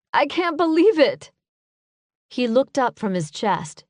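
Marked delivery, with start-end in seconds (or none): 0.1-1.3 higher, louder distress; can't take it in, cry it out; alarmed outburst. A narrator's reading. Narrator cries out at his wound, alarmed.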